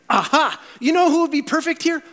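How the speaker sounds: light up with the idea; sudden bright idea. Retold or imitated. Barnabas realising who fits Antioch, excited and high.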